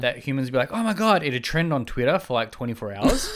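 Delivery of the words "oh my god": high, loud imitation of amazed people; mock excitement. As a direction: play an astonished crowd online. Imitating humans reacting to aliens.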